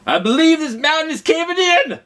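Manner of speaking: high, loud frightened holler; terrified boss hollering in the dark tunnel; scared holler. Acted. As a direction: yell it out in panic. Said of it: "He got scared and hollered".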